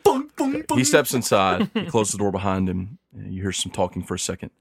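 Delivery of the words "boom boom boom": imitated pan clanging. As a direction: mimic the clanging pans. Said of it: "he's literally going".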